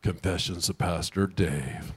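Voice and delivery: low, soft announcer aside; a quiet mock title read for the congregation; mock show title. Pastor announces his own confession as a mock show title.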